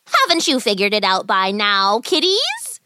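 put-on wicked old witch toying with children; taunting witch.

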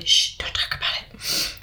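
hushed secretive whisper; mock shushing whisper. Old health class hushing the topic, mock whisper. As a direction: whisper it like a prudish health teacher. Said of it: "But mostly it was like".

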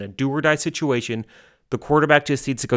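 normal talking voice

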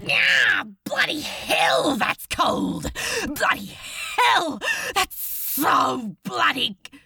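high, strained hiss at icy cold; pained, breath-hissed swearing. Acted. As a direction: curse through the shock of freezing water. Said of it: "Crowley hissed in pain".

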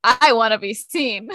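attention-seeking outfit voice. Acted. Friend voicing the bold half of an outfit, showy and loud. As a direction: announce it as if showing off. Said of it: "the other part of the outfit says".

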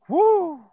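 high, loud shaky exhale; shaky sigh. Real, not acted. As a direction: blow out a shaky breath. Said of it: "I'm trying not to cry right now".

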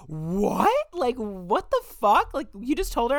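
high, loud mock astonishment; astonished friend. Best friend hearing the news, astonished. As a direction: imitate a friend's loud, high-pitched disbelief.

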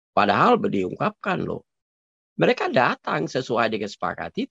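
ordinary talk, nothing marked

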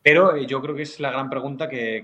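usual speaking voice

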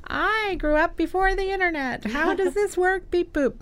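put-on clueless old-timer. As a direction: play the baffled elder. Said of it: "the old who is like".

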